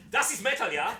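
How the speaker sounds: put-on loud, high German-accented shout; hammed-up German accent, booming; mock German accent. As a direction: declare it like a German metalhead. Mock German metal band catchphrase.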